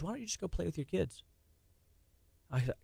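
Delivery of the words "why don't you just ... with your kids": soft, quick wife's suggestion; gentle wife. Retold or imitated. Wife gently telling stressed husband to play, much softer. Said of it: "She goes".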